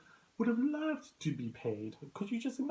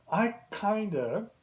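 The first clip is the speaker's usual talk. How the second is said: usual speaking voice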